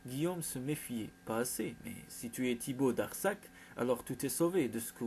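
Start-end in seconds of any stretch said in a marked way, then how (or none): none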